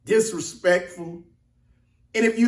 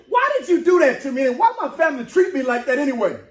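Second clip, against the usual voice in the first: higher, indignant retort; voicing Jephthah; 7 semitones higher, 3 dB louder, faster than usual. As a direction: throw it back at the elders, hurt. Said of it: "Here go Jephthah".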